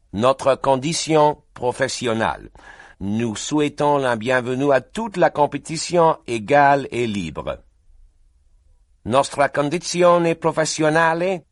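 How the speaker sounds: translate the resolution for the room; French and Italian translation. Acted.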